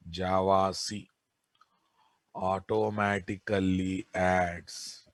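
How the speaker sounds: slow, soft dictation for note-taking. Acted. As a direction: read it out word by word, slowly. Dictating notes slowly.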